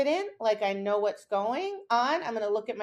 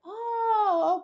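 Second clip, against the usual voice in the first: dog's delighted surprise; 11 semitones higher than usual. Retold or imitated. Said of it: "she's like".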